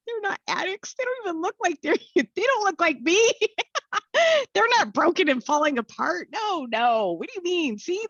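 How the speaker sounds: high-pitched, agitated disbelief; acted-out bewildered protest, voice way up; incredulous. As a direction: reel off protests in a high, strained voice. Acting out her panicked disbelief at meeting.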